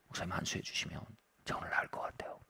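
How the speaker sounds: soft whisper, barely voiced; young man whispering his plea to the pastor. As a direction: whisper it like a secret hope. Young man's quiet plea to pastor.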